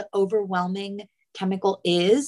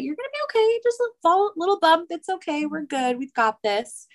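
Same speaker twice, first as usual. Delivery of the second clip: upbeat coaxing that starts high and eases down; 9 semitones higher, faster than usual. Acted. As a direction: imitate soothing a child after a tumble. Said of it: "try to coax them in that way".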